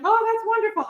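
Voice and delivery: high-pitched imitation of a delighted parent. Mother imagining any other parent's reaction, delighted. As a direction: gush lightly, as a pleased parent would. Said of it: "any other parent would have been like".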